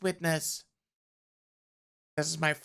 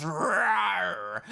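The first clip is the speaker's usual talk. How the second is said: drawn-out growling roar; bear roar; 3 dB louder than usual. Acted. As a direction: roar like the lunging grizzly. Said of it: "The bear lunges and roars".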